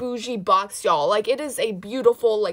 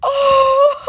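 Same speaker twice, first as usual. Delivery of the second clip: very high, loud shrieking laugh; delighted laugh; 10 dB louder than usual. Real, not acted. Collector overcome by her doll, shrieking laugh. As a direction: burst into giddy laughter. After reveal.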